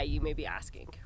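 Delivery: playful stage whisper. Acted. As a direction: lean in and whisper the question. Playful rhetorical aside.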